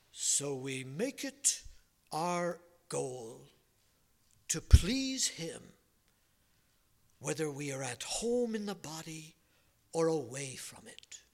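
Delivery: slow, lightly done British preacher reading the verse; a hint of an old British preacher, drawn out; British accent. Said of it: "And in his British accent".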